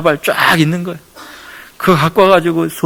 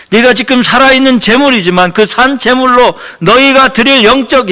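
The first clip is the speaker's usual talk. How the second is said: raised, ringing reading of the verse; scripture proclamation; 4 semitones higher, 3 dB louder than usual. Acted. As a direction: proclaim God's word to the congregation. Reads Paul's words in scripture. (말씀을 선포하듯 높여 읽기)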